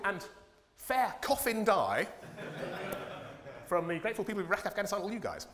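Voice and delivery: high, chummy mock Blair voice; Tony Blair impression. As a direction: play a smug, matey leader showing off gifts. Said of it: "So here he is".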